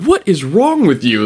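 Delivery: high, loud horrified question; alarmed outburst. Retold or imitated. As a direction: recoil in horror as you ask. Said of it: "they're like".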